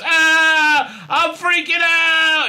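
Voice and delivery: high, loud, drawn-out fake wail; hammed-up fearful freak-out; mock panic. Woman affected by fear, hammed-up panic. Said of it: "she gets affected by fear".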